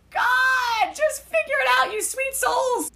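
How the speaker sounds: high, squealing cry of exasperation; exasperated outburst. Real, not acted. Reader begging fictional sweethearts to work it out, exasperated.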